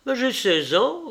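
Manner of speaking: high, defensive retort; indignant protest. A narrator's reading. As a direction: protest hotly, pitched well up. Brother protests against sister's scolding. (protestation indignée, voix haute)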